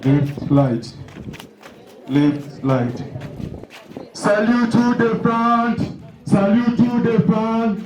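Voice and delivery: drawn-out parade ground commands; drill commands. Acted. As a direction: call each order to the whole parade.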